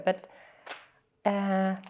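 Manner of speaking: usual speaking voice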